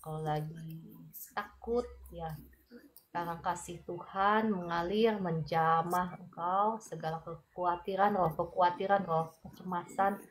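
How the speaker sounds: soft, tender prayer against fear; calm the worried one softly as you pray; gentle prayer. Acted. Prayer for an anxious viewer. (doa lembut yang menenangkan)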